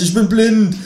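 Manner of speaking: high, loud cry of shock; panicked cry. A narrator's reading. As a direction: shout it out, blinded by light. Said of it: "schrei ich".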